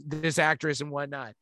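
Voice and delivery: normal talking voice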